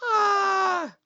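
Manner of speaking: strained cry. Real, not acted. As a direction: cry out hard enough to hurt. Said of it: "That hurt my throat a little bit".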